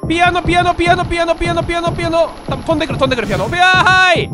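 panicked shouting. Real, not acted. Panicking as pianos fly.

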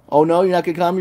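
ordinary talk

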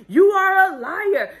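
high-pitched mock holler; imitated hollering. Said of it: "Giselle is hollering".

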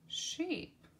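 sheep sung out high; high voiced repeat. Shown as a demonstration. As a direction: brighten sheep way up. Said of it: "second take of word".

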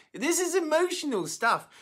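real welling-up, pitch riding high; high, emotional voice near tears; getting teary. Real, not acted. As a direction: choke up as the story moves you. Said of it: "I'm getting teary already".

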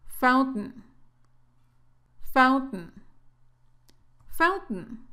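"fountain" as "fount'n", glottal stop and no final vowel; "fountain" with a glottal t, final syllable swallowed. Shown as a demonstration. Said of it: "como que si te tragaras esta última sílaba".

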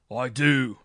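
a loud, booming defiant "I do"; thundering reply. A narrator's reading. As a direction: thunder it back at the judge. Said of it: "thundered the marshal".

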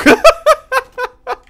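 loud, high-pitched burst of laughter. Real, not acted. Player cracking up at his bit, high laugh. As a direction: crack up at your own joke.

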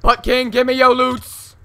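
loud, slow battle cry. Acted. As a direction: bellow it like a warrior.

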